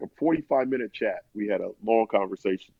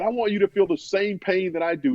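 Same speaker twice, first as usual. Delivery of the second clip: higher-pitched retold rage, not shouted; building anger; 7 semitones higher than usual. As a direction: imitate rage building toward the listener. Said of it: "building this rage and this anger".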